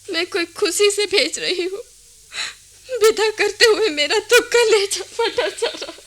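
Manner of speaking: a mother crying as she sends her sons away; tearful mother. Acted. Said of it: "अब रोना धोना बंद करो।". (रोते हुए, टूटी आवाज़ में)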